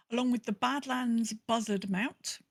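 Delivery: ordinary talk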